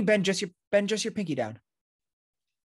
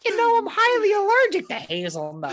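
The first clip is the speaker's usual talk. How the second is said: much higher and louder, prissy complaint; squeaky put-on lady voice; high mock woman voice; 6 dB louder than usual.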